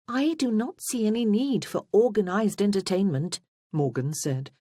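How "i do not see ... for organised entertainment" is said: higher-pitched, decided objection; opinionated younger sister. A narrator's reading. Morgan dismisses organised entertainment, opinionated. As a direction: lift the voice and object firmly.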